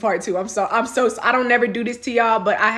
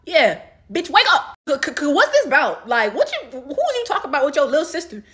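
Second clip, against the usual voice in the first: retold confrontation; 5 semitones higher than usual. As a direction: confront him as you wake him. Acted confrontation with sleeping boyfriend.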